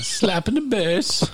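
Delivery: very high, slow squeak; high squeaky catchphrase. Retold or imitated. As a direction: squeak it out slowly. Quoting the movie catchphrase.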